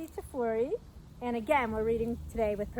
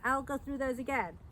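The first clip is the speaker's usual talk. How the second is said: ordinary delivery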